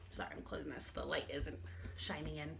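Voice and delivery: muttered aside off mic; quiet aside. Real, not acted. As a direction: drop the voice for a side remark. Aside while closing the blinds.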